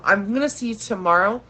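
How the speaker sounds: ordinary delivery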